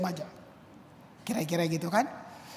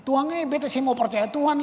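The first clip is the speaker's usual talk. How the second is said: higher, louder defiant complaint; threatening God; 6 semitones higher, 5 dB louder than usual. Retold or imitated. Friend praying to God over sick baby, mock defiant. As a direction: push back at God, half-joking. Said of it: "yang bisa ngancam-ngancam Tuhan".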